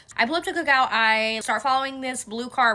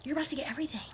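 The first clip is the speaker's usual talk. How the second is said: soft teasing whisper, softer; 4 semitones higher, 9 dB quieter, much faster than usual. Real, not acted. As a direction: lean in and whisper the tease. Teasing whisper before the story.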